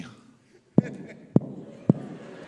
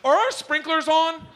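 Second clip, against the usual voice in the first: much higher, chirpy mock voice; mock housewife; 11 semitones higher than usual. Comedian mocking a wife mid-act, high mock voice. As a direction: ask it like a distracted suburban wife.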